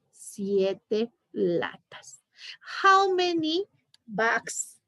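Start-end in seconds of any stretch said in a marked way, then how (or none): 0.1-2.2 breathy whispered answer and question. Shown as a demonstration. Teacher whispering the answer to her student, whispered.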